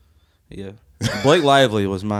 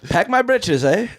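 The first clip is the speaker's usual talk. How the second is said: "britches" squeaked much higher in fake British; terrible British accent again; mock British accent; 9 semitones higher, slower than usual. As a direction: camp it up in a silly British voice. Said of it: "Pretty sure he said, "Pack my pants".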